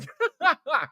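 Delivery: high-pitched burst of laughter; short real laugh, pitched high. Real, not acted. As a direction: crack up laughing at your own joke. Laughs at his own joke.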